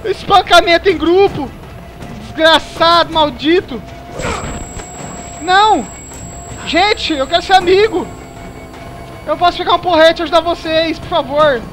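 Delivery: loud, high desperate pleading; panicked yelling. Real, not acted. Beaten by group, begging.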